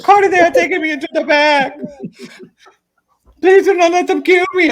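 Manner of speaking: hammed-up high-pitched terror; terrified friend. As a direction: squeal it like she's being dragged off. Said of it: "she's like".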